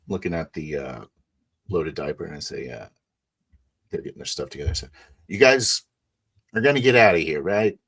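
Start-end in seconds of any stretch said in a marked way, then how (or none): 5.3-7.8 menacing warning. Acted. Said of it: "you're not the guy I wanna piss off".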